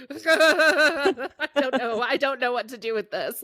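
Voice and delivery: high, slow, laughing through the words; laughing helplessly. Retold or imitated. Retelling own overwhelmed reaction.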